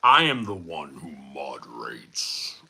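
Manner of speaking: a deep, slow mock-villain reading; deep mock overlord. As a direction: intone it like a self-important ruler. Reading a chat message aloud.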